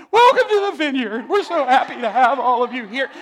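high, very loud over-the-top greeting; frantic welcome. Acted. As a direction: shout a nervously overexcited church welcome. Acts his frantic opening welcome.

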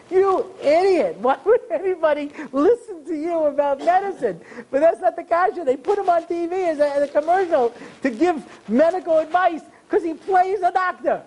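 high-pitched mock outrage; incredulous rant. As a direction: rant in sheer disbelief at the absurdity. Ranting at the actor, incredulous.

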